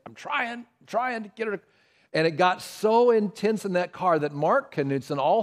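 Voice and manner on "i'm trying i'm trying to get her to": strained, higher and softer, at wit's end; a tired father pleading over a crying baby; exasperated dad. Retold or imitated. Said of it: "[name]'s just saying".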